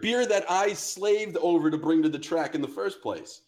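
high-pitched, worked-up reenactment of outrage; indignant rant. Retold or imitated. Friend defending the beer he brought, indignant retold rant.

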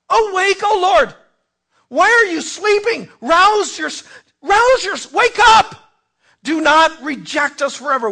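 crying out high and loud to a sleeping God; raw, rising plea at full voice; desperate shout. Acted. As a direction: shout it up, begging him to wake. Pleading psalm cry to God.

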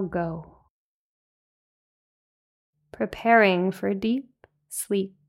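unhurried meditation guide with long pauses; spacious, calming breath-by-breath guidance; guided meditation. Real, not acted. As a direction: lead them gently into rest, phrase by phrase.

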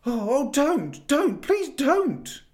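high, loud, slow desperate plea; Edmund shouting. A narrator's reading. Edmund begs the witch not to use her wand, shouting. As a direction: beg her to stop. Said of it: "shouted Edmund".

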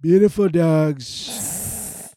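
very slow, loud Trump drawl; Trump impression. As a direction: Trump praising things. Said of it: "Trump impression continues".

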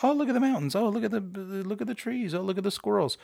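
lightly hammed-up wonder, starting high; mock sightseer. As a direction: play a road-tripper pointing out sights, in jest. Mocks idle sightseeing on a road trip.

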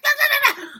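imitating the dog in mock panic; panicked imitation. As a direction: squeal no like a frightened pet. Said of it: "Demon is, like, freaking out".

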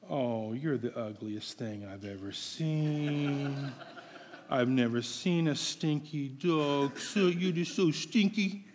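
soft, low, sweet-talking with a laugh; sweet baby talk. Shown as a demonstration. Preacher cooing insults to his dog, soft and sweet. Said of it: "you're saying it so sweetly".

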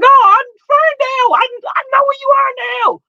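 high, loud, squealing joy; excited. Retold or imitated. Host retells finally seeing Ferndale, squealing high and excited. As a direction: squeal it fast like you just found the place. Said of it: "I was excited".

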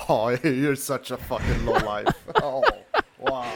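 real laughter, teasing a friend; laughing disbelief. Real, not acted. Laughing at friend's funeral joke.

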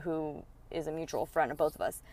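ordinary delivery

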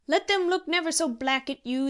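insistent, loud persuasion; arguing. A narrator's reading. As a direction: argue him out of his fear. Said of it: "argued Spriggs".

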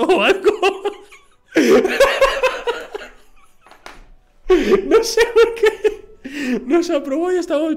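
high, slow words squeezed through laughter; real laughter breaking the words; laughing hard. Real, not acted. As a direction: crack up as you speak. Laughing at passing.

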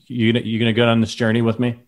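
usual speaking voice

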